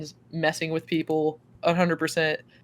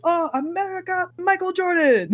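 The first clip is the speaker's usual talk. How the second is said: very high mock-stranger exclamation; high, hammed-up impersonation; imitated stranger; 12 semitones higher, 4 dB louder than usual. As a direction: mimic his delighted recognition, pitched way up. Mimics man naming American things.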